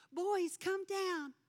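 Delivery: high, slow sing-song call up the stairs; calling the boys. Retold or imitated. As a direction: call upstairs, drawn out and high. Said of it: "and I'd say".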